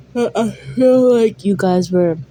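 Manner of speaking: talking through a yawn; words stretched by a real yawn. Real, not acted. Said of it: "just by my yawn".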